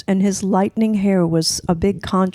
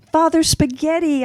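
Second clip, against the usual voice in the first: retold shout; 11 semitones higher than usual. Boy greeting priest, high eager shout. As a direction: call it out high and eager across the steps. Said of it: "I shouted in greeting".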